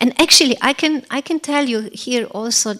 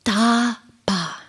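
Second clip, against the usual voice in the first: the syllable "Tapa" voiced apart, like a voice from afar; otherworldly utterance of "Tapa"; divine voice. A narrator's reading. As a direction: say "Tapa" as a distant call from the heavens. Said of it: "The two syllables".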